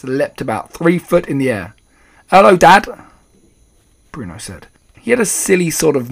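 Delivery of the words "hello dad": high, loud greeting; cheerful mouse boy. A narrator's reading. Mouse boy greeting his shocked father, cheerfully. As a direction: call out brightly to Dad.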